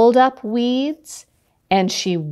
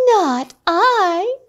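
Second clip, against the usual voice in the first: cat refusing; 9 semitones higher than usual. Acted. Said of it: "said the cat".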